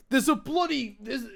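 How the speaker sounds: high, loud mock British accent; British accent, played up. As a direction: slip into a cheeky British accent. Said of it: "I don't know why I'm doing that accent".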